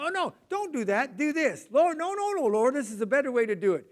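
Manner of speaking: high, loud, insistent correcting; a stepdad barging in on the breakfast routine; bossy exclaiming. Retold or imitated. Stepdad correcting the kids' breakfast routine, loud and bossy. Retelling bossing stepkids at breakfast.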